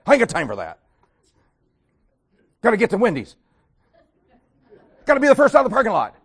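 loud, hurried mock brush-off; impatient churchgoer. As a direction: rush it like you're late for lunch. Mocking churchgoer rushing to leave.

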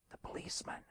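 put-on very soft, secretive child's whisper; little kid's whisper. Little kid tells boss who is there, whispering. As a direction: whisper like a tiny kid on the phone. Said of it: "this little kid's voice".

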